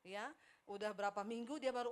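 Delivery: ordinary talk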